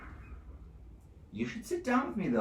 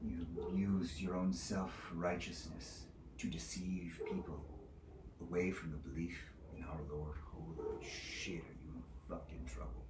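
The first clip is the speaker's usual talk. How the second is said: hushed rebuke; 3 semitones lower, 11 dB quieter than usual. Acted. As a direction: let the accusation sink down into near-whisper. Judge's low menacing rebuke.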